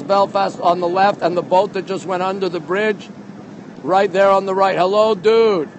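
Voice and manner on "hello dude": ordinary talk, nothing marked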